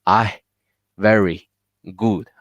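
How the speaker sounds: "I", "very", "good" each set apart by a pause; needlessly split words. Shown as a demonstration. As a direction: break "I very good" into separate single words. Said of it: "There's no need you say".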